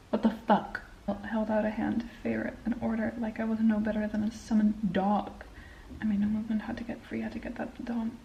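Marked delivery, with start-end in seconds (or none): 1.2-8.2 soft book reading, dropping quiet; hushed read-aloud of the novel. Acted. Reads book passage aloud.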